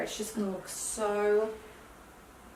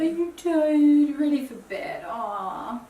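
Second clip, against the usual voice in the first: slow, high, loud sing-song coo; 4 semitones higher, 6 dB louder, slower than usual. Real, not acted. Mother coos at tired child.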